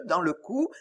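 usual speaking voice

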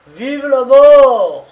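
huge, high-pitched crazed cry, drawn out; mad thundering shout. A narrator's reading. Condemned man crying out before the firing squad, mad thundering shout. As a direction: bellow it like a madman, long and thunderous. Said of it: "il cria d'une voix folle, d'une voix tonnante".

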